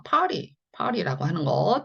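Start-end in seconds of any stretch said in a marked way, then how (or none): 0.0-0.6 native-style "party" said as "파리", T not hard; native English pronunciation. Shown as a demonstration. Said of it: "T 발음을". ("파리", 딱딱하지 않은 티의 원어민 발음)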